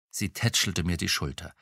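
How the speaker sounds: plain reading voice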